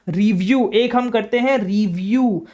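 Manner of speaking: ordinary delivery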